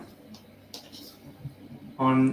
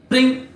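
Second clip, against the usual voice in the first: ding sound effect; 10 semitones higher, 4 dB louder than usual. Acted. GM makes the chair sound.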